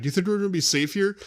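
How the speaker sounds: nervous mock soldier, quick and high; imitating a rebel. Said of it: "one of the rebels was like".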